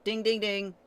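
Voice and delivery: excited ding ding ding; a sudden lightbulb chime; idea ding. Real, not acted. Realizes the missing light cone.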